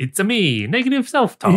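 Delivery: high, hammed-up Italian Mario-style accent. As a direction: ham up a cartoon Italian accent. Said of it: "in that Italian voice".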